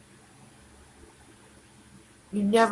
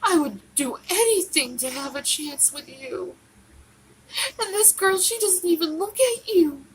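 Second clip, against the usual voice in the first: higher, tearful pleading through crying; 9 semitones higher than usual. Real, not acted. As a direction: beg him through real tears.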